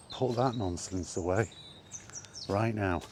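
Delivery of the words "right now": ordinary delivery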